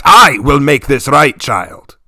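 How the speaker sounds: firm retort to the daughter. Acted. Father retorts to his pleading daughter, firm. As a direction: cut her off firmly. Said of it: "I retorted".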